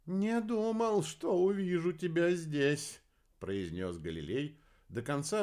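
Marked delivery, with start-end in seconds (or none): 0.1-3.0 high, slowing character voice; high-pitched dazed old acquaintance; Galileo's voice. A narrator's reading. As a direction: voice a half-deaf man, high and drawn out.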